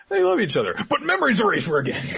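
high, sing-song mock lament. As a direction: whine it like a soap fan. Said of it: "I was like".

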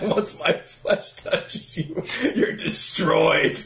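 slow creepy pillow talk; hammed-up creepy come-on; mock seductive menace. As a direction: draw it out slowly, half laughing. Mock sexy talk quoting villain line.

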